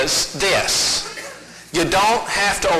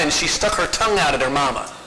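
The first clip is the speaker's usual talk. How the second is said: ordinary delivery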